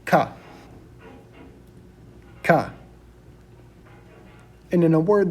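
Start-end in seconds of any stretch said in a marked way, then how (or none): 0.1-2.7 "ka" pronounced clearly as a model; Spanish letter name. Shown as a demonstration. Teacher modelling the Spanish letter K for students, demonstrated "ka". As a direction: say "ka" as an open "kah", set apart. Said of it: "which is pronounced".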